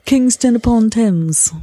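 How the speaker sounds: slow, hammed-up English accent; mock English accent. Shown as a demonstration. Podcaster joining in the British accent bit, hamming it up. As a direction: savour each syllable in English posh-ish tones.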